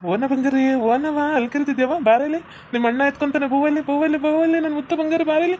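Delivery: high cooing that keeps climbing; playful high-pitched calling to a little one; baby talk. Acted. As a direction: coax a baby over in a high sing-song.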